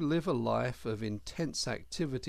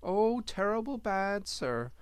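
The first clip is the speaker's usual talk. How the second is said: higher, humble little hedgehog; earnest servant boy voice, higher; young hedgehog voice; 9 semitones higher than usual. A narrator's reading. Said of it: "said the young Hedgehog".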